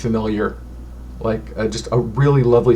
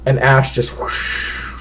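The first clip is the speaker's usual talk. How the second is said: usual speaking voice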